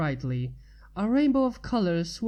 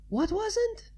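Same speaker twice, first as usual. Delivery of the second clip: Pinky voice; 13 semitones higher than usual. A narrator's reading. Pinky voice high.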